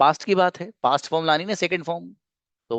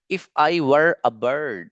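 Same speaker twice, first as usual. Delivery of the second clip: slow model sentence; much slower than usual. Shown as a demonstration. Teacher models English sentence.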